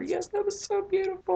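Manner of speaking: high, squealing delight over the episode; gushing fan. Retold or imitated. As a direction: gush like a thrilled fan. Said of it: "usually I'm like".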